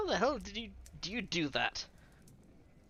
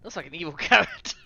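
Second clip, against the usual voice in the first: ordinary talk, nothing marked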